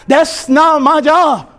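ordinary delivery

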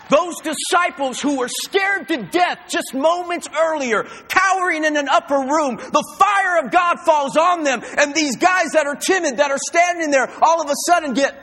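loud, rising sermon voice; fiery preaching. Acted. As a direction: preach it with full fire. Preaching at full force.

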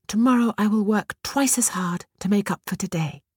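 put-on high girlish promise; girl promising. A narrator's reading. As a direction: promise it earnestly. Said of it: "she promised".

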